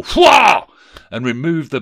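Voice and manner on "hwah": huge high shouted wail; terrible ghostly cry. Acted. Ghost terrifies Scrooge with a shouted cry. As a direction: let out a sudden booming "hwah". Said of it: "the ghost raised a terrible".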